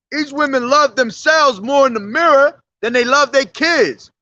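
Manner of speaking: usual speaking voice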